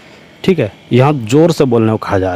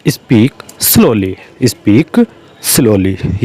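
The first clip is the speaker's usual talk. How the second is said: each word spaced out slowly for the class; slow dictation; 2 semitones lower, much slower than usual. Shown as a demonstration. Teacher spelling out an English sentence for learners, slow and deliberate. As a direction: dictate it slowly so students can follow. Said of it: "slow dictation of English phrase".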